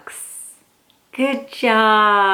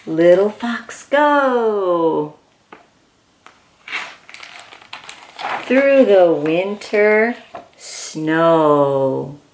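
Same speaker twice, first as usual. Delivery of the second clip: storybook reading; 3 semitones lower, slower than usual. Acted. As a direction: read it gently like bedtime to a toddler. Reads picture book aloud.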